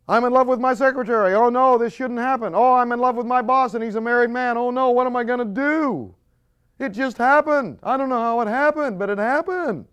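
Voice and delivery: high, loud fast flustered wailing; hammed-up panicked lovers; mock panic. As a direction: fret over falling in love.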